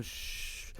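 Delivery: unvoiced hush; whispered shh; soft shush. Shown as a demonstration. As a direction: hush someone gently. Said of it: "I just pictured myself going".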